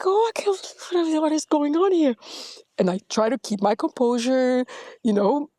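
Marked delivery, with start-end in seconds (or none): 0.1-2.2 amazed inner voice. Retold or imitated. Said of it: "and I'm like".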